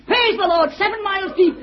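put-on old man laughing with joy; jubilant cry. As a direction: burst out in delighted praise. Billy exulting over the book.

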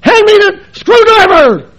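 loud, high, slow barked demand; mock tense shout at his wife. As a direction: snap out the order all tense. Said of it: "get all tense and".

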